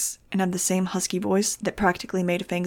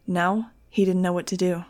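ordinary delivery, nothing marked

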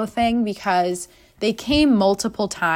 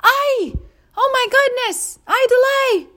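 put-on much higher, Mexican-accented yelps; mock Mexican guards; 4 dB louder, slower than usual. Security guards seeing a naked man, shocked Mexican accent. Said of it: "they're like".

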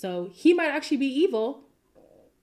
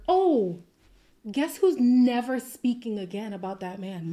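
ordinary delivery

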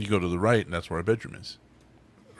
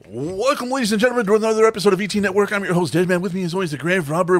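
high, bright announcer welcome; show host intro; 7 semitones higher, 3 dB louder than usual. Acted. Host welcoming listeners to the episode, announcer voice. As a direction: sell the show opening like a host.

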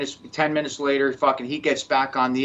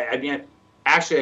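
ordinary talk

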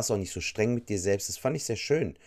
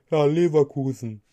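mock audience member; 4 semitones higher, 6 dB louder than usual.

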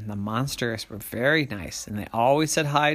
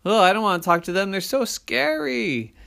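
scared monster; 9 semitones higher, 5 dB louder than usual. A narrator's reading. A monster balking at talking to people, scared. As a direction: play a frightened monster dreading the visit.

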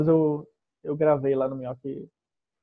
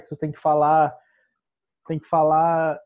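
louder, pushed out to the back of the bar; projected stage voice; projecting the voice; 5 dB louder than usual. Shown as a demonstration. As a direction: speak out to the room, not shouting. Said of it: "expondo mais a tua voz".

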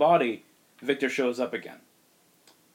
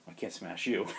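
low soft Hulk voice; 4 semitones lower, 9 dB quieter than usual. Acted. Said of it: "And Hulk is like".

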